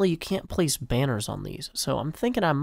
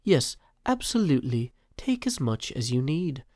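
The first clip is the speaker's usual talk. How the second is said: refined British accent. Shown as a demonstration. Rereading Mumbo's reply in a refined British accent. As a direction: lay on a polished RP British accent. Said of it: "received pronunciation there".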